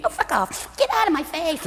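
high, soft, snippy rebuff; mock high-voiced put-down; dismissive dancer. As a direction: play an exquisite dancer brushing off an admirer.

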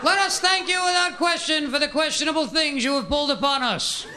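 high, sing-song froggy preaching; Kermit the Frog priest. Retold or imitated. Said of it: "priest delivery".

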